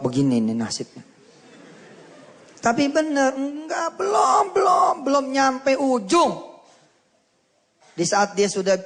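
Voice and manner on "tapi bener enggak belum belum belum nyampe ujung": high, drawn-out exclamation building to a shout; emphatic cry. Real, not acted. Preacher tells listeners the journey isn't over, emphatic cry. Preacher driving home the climax.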